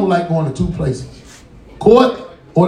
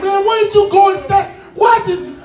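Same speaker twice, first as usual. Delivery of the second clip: shout; 11 semitones higher than usual. Acted. Brother yelling.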